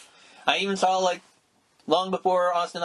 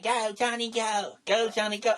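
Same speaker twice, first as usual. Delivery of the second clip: put-on light Southern-accent cheer, a bit higher; Southern girls cheering; 6 semitones higher than usual. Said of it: "twenty girls with thick Southern accents saying".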